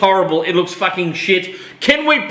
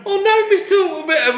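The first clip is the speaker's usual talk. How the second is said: high mock child voice; youngling impression voice; 11 semitones higher than usual. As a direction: imitate a little youngling in dismay. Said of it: "the, the, the youngling".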